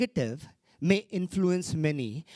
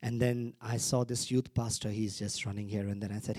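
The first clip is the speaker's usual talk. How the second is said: ordinary delivery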